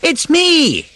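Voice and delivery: loud, high, drawn-out calls through the woods; calling out. A narrator's reading. Rat calling for the lost mole in the woods, loud. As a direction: shout for a lost friend in the dark. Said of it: "all the time calling out".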